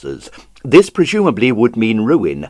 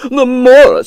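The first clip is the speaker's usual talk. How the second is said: much higher and louder, livid; 14 semitones higher, 9 dB louder than usual. Acted. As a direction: explode at the insult. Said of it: "livid and quivering".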